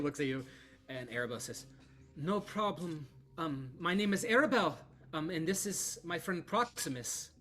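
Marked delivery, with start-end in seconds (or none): 2.2-7.3 higher, louder, bright and welcoming; friendly Vistani woman. Acted. Arabelle introduces herself and Proximus to Lydia, friendly. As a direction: greet the stranger cheerfully.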